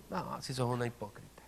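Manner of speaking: a lightly voiced, hushed, sneering tempter; lightly voiced devil whispering doubt to a believer; voice of the enemy. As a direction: murmur the enemy's taunts low and soft. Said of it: "viene el enemigo".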